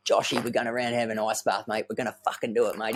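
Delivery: lightly voiced pumped-up mate; fired-up mate. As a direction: hype him up, mate to mate. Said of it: "He's right into it".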